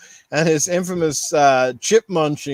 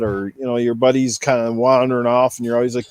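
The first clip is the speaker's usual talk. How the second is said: normal talking voice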